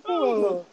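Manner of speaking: imitated heavy puff; mock out-of-breath huff; running panting noise. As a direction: act out being winded from running. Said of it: "That's the noise I made".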